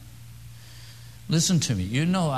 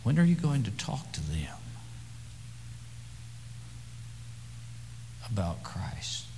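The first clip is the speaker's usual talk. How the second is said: hushed whisper; 6 dB quieter than usual. Acted. Preacher whispers to the congregation, hushed and urgent. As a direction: lean in and breathe the question.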